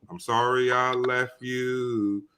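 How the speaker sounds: ordinary talk, nothing marked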